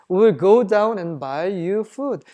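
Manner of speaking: slow, measured Bible reading; scripture reading. Acted. Preacher reading the verse to the congregation, slow and measured. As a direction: read each English word out slowly. Reads scripture aloud slowly.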